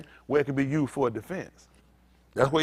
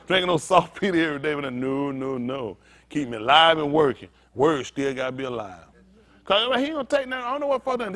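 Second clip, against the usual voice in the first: ordinary talk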